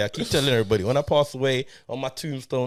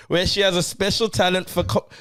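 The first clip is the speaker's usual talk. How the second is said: higher-pitched, wobbly fake Scouse; attempted Scouse accent; 6 semitones higher than usual. Host finishing the synopsis for co-host, attempted Scouse accent. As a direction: keep up a bad Scouse accent to the end. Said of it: "I don't know what that was".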